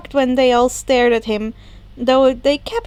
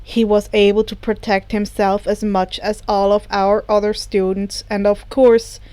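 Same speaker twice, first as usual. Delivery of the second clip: a lightly lower, steady teacher voice; Aizawa's voice; 4 semitones lower than usual. A narrator's reading. Aizawa answers reporters about his student, lightly lower and measured. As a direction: answer the press calmly, a touch lower.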